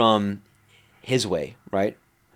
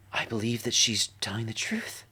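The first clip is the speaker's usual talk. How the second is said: hushed whisper of awe; 8 dB quieter than usual. Retold or imitated. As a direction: breathe it out in a whisper. Jacob's awed reply, retold.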